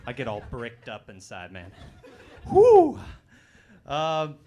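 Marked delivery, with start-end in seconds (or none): 2.5-3.0 loud high whoop. Acted. Mock excited exhale.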